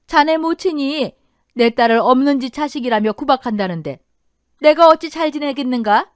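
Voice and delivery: loud, indignant mother-in-law; indignant reproach. A narrator's reading. Mother reproaches son-in-law for his mother's bullying, indignant. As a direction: throw the greeting back as a reproach.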